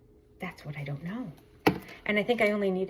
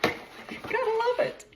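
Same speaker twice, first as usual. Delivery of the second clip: high, slow, laughing; giddy laugh at a lucky bargain; laughing delight; slower than usual. Real, not acted. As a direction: squeal it out through a laugh. Laughs at her lucky deal.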